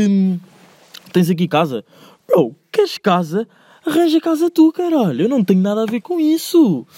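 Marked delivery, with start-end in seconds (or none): 2.3-6.9 real annoyance, high and heated; irritated outburst. Real, not acted. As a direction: pitch jumps way up on an exasperated rant. Ranting about fake friends.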